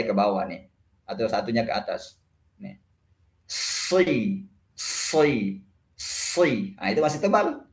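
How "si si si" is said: SI with a heavy, thickened s and darkened vowel; thick emphatic shad. Shown as a demonstration. Teacher showing students the lips don't matter, thick SI. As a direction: say SI thick, tongue raised at the back. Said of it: "saya contohkan tidak ada pengaruhnya di bibir".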